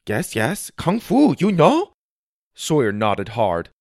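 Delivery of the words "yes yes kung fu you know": put-on higher, eager accented guide; light Chinese accent, delighted; tour guide Wang. A narrator's reading.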